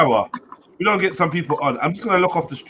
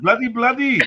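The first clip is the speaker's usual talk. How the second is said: higher mock yell of "bloody, bloody"; mock rage shout; 6 semitones higher than usual. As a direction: bark "bloody, bloody" like the angry driver. Quoting the angry man.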